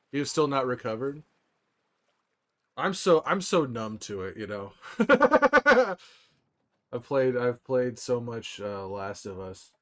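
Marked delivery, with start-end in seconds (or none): 4.8-5.9 sudden high burst of laughter; big laugh. Real, not acted. Streamer laughing loudly at his chat.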